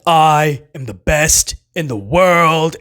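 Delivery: slow, stiff, self-serious catchphrase. Retold or imitated. Podcaster mocking a cringe heel promo, slow and smug. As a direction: drag it out like a smug suit-and-tie heel. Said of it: "he would just talk like this".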